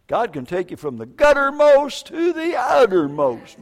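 high, loud preacher's exclamation; fervent proclamation. Real, not acted. Preacher exclaims over a saved heroin addict, fervent. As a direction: proclaim it with soaring pitch and force.

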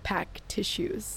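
deadpan. Retold or imitated. Deadpan punchline.

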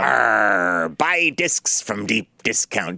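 slow growly pirate; pirate puppet pitching discs slowly. Pretend. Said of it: "little hand puppet".

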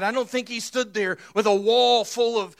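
normal talking voice

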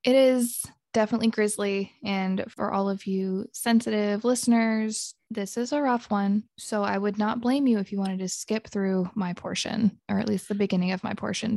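usual speaking voice

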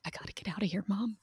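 small, hushed plea; imitated panicking child. Mom imitating daughter begging to leave, hushed panic. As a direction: keep it very soft, a child's urgent aside. She's losing her shit.

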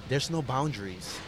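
ordinary delivery

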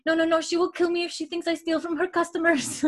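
put-on high-pitched pleading kid with a French accent; French-accented boy pleading. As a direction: beg as a little French boy. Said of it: "starts speaking English with such an accent".

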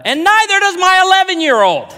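high, loud comic punchline; comic high squeal. Retold or imitated. Punchline, voice jumps way up.

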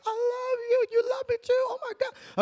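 high, tearful cry of love; weeping. Retold or imitated. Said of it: "you're weeping for the first time".

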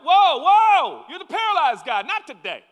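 loud high alarm, stopping him; mock outraged shout. Law-keepers to the healed man, loud startled objection. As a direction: call out whoa like startled guards.

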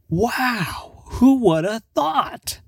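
high-pitched, drawn-out fake wonder; mock amazement. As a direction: act astonished at the obvious, slowly. Mock amazement at an obvious match.

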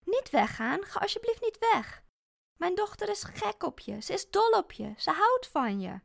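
high, pleading voice begging her to stay; desperate pleading. Acted. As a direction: beg her not to go. Moeder smeekt Elsa te blijven. (hoog, smekend)

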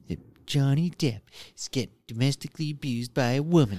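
lightly exaggerated taunting voice; exaggerated attitude voice. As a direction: sneer it out with attitude. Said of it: "you go into that voice".

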